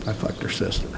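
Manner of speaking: breathy, suppressed laugh through the words; almost whispered, stifling a giggle; laughing confession. Real, not acted.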